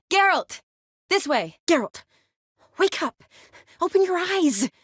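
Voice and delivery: high, pressing call to rouse someone; alarmed, high-pitched urging; urgent calling out. Acted. Calling, then trying to rouse Geralt.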